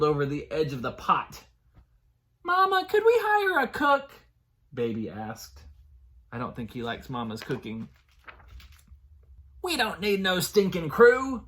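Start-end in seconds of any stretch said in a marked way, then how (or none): 2.4-4.1 put-on high, loud little child. Baby pirate voiced. 9.6-11.4 loud, higher gruff pirate bark. Acted. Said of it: "Mama barked".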